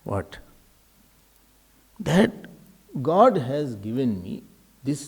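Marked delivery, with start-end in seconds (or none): none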